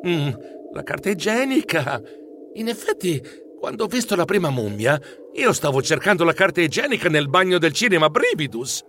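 plain reading voice

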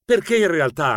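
ordinary delivery, nothing marked

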